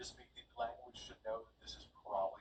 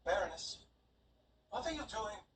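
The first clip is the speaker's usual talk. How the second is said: raised, higher, startled servant; handmaid's alarmed cry; 9 semitones higher, 5 dB louder than usual. Acted. Handmaid catching her lady choking, alarmed. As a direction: call out to stop her mistress. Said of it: "and is going to say".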